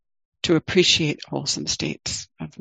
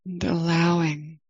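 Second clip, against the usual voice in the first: guided meditation. Acted. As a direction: ease listeners into the body, gently.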